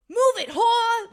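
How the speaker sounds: loud, high, drawn-out Boston holler; rowdy mock-Boston shout, stretched out slow; wicked Boston accent. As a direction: yell it like a drunk Bostonian. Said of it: "had a wicked Boston accent".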